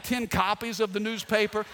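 ordinary delivery

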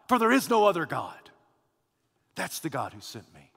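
ordinary talk

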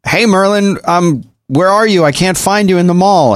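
high-pitched mock voice message; calling into walkie-talkie. User radios a friend lost in the mall, calling out.

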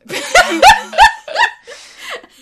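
loud, high, long laugh. Real, not acted. Laughing at her own Enemy of the State shorts, loud high laughter. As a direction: let a big laugh roll out.

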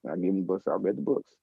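ordinary delivery

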